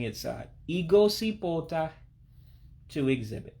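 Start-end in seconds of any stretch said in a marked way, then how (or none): 0.7-1.9 Igosipota higher, as ee-go-see-pol-tah; careful teacher's model of Igosipota; practice pronunciation. Shown as a demonstration. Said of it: "just a matter of practicing".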